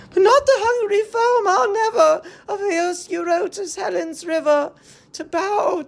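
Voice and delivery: high, fast, crying protest; anguished refusal. Acted. A captive refusing to serve Menelaus, high and crying. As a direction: cry out high against it.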